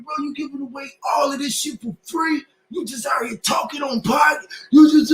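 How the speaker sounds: high, loud scolding rant; mock scolding shout. People scolding the rapper for giving music free, high shouted rant. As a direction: berate him for giving it away. Retelling critics shouting he should sell.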